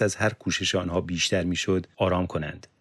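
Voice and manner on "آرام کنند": ordinary delivery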